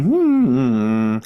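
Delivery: short loud nasal hum; loud hum demo. Shown as a demonstration. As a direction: hum loud enough to buzz the teeth. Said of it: "We're gonna hum so loud".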